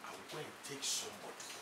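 low stern threat. Acted. Husband's low stern ultimatum.

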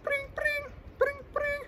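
high sing-song bring bring; phone ringing. Pretend. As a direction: ring like an old telephone.

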